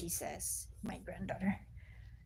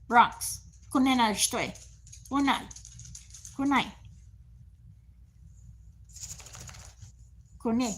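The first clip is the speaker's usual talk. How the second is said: loud firm commands to the dog; calling the dog; 3 semitones higher, 5 dB louder than usual. Real, not acted. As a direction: call him sharply so he listens.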